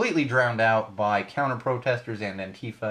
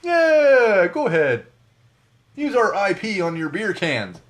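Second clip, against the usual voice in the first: high, loud sarcastic cheer of permission; exaggerated, high-pitched enthusiastic yes; mock eager approval; 8 semitones higher, 8 dB louder, slower than usual. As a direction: whoop it up as an absurdly agreeable company.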